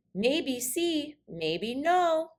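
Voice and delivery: a hint of a sly granny; teasing old lady. A narrator's reading. Fairy godmother teases.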